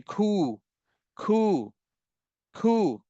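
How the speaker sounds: "खू" with a throaty kh and round u, said over; teacher demo of खू; pronouncing खू. Shown as a demonstration. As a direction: model "खू" from the back of the throat. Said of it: "उसको कैसे बोलते हैं".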